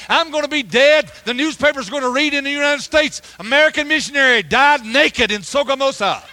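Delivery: loud, high-pitched preacher's storytelling; worked-up retelling. Retold or imitated. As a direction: retell the panic at full volume. Panicked climax of mobbing story.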